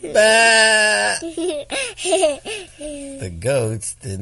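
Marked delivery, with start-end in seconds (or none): 0.0-1.2 high loud bleat; playful sheep bleat; sheep baa. Acted. Parent acts sheep sound.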